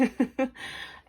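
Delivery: a short breathy embarrassed laugh; quick self-conscious giggle; light laugh. Real, not acted. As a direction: laugh it off, a bit sheepish. Embarrassed after a specific message.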